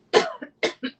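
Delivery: sharp choking cough; cough, something caught; coughing up water. Real, not acted. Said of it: "went down the wrong way".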